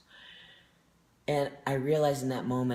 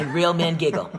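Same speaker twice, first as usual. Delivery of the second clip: louder and higher, giggling through the words; laughing; 5 semitones higher, 11 dB louder than usual. Real, not acted. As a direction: laugh while you proclaim it. Giggling through the rallying cry.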